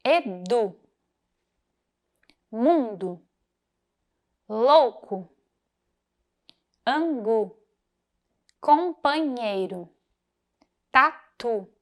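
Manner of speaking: slow, separate words with long pauses; read-along dictation. Shown as a demonstration. Teacher reading words aloud for pupils to follow, slow and measured. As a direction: read each word slowly for pupils to repeat. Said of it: "Vamos ler juntos".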